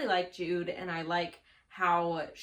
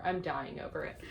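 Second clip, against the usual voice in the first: ordinary delivery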